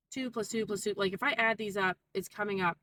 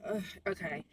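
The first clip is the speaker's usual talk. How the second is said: softer, put-upon groan, then agreeing; perturbed; 6 dB quieter than usual. Retold or imitated. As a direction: imitate a mildly bothered manager.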